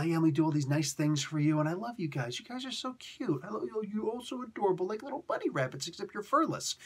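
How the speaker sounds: a hint of baby talk to cute pets; doting goddess voice. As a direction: coo at the humans like a fond mother goddess. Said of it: "she goes down and she starts".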